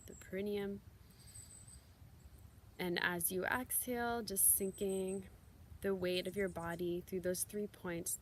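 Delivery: soft, unhurried meditation guide voice; guided meditation. Acted. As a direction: gently lead them into the breath and the body. Leads a guided grounding meditation.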